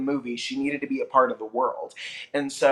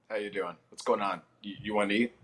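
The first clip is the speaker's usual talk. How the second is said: his real low voice; 7 semitones lower, faster than usual. Shown as a demonstration. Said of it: "My actual voice when you come over".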